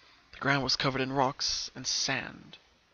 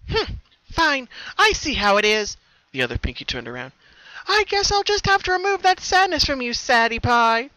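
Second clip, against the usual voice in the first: much higher, louder, sweetly menacing; a cheerful threat; creepy sing-song warning; sinister other Pinkie; 11 semitones higher, 8 dB louder than usual. A narrator's reading.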